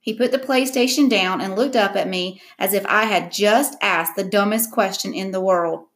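ordinary delivery

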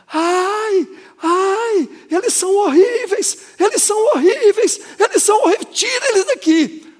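loud, very high wailing cries of horror; imitated terrified scream. Preacher re-enacting the girl screaming at demons, terrified high shriek. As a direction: act out her shrieking panic. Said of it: "virou um grito de pavor. Essa menina gritava".

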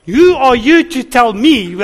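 mock indignant retort; mock snap. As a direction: bristle back at the question, half joking. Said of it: "almost started the whole cycle again".

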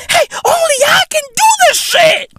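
cocky shouting brag; excited shout. Real, not acted. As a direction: shout it out like a victory lap. Boasting that only he can.

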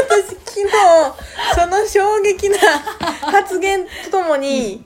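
slow words broken by real laughter; giggling as she tries to tell it; laughing while recounting the shocking remark; laughing through words. Real, not acted.

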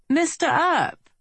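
polished, courteous British greeting; British accent. A narrator's reading. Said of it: "This accent was British".

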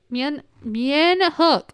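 "Mien, Mien Hook" drawn out slowly, each syllable spelled out; careful enunciation. Real, not acted. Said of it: "inhibiting my ability to enunciate properly".

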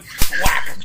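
loud, punched whack sound; sudden loud smack noise; imitated thwack. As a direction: hit it like a switch landing. Imitates switch hitting.

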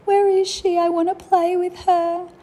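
pretend searching child. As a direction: whine like a small kid looking for her sister. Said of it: "And I had to say".

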